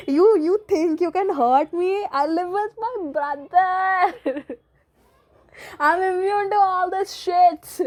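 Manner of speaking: high, loud, slow taunting with a laugh; mock taunt. Taunting a YouTube hater, a slow laughing high gloat. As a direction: gloat slowly, half laughing.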